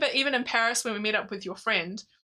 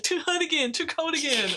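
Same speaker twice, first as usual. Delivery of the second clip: higher whiny moaning voice; whiny complaining; 7 semitones higher than usual. Retold or imitated. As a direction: moan like a grumpy husband. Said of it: "That impression is excellent".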